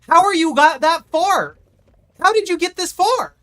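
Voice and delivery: high, loud incredulous cry; real frustrated disbelief; exasperated disbelief. Real, not acted. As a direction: demand it of the screen. Chasing survivor, exasperated.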